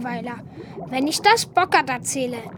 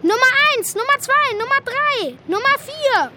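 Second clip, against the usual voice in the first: calling out; 7 semitones higher than usual. Acted. Calling frogs one by one.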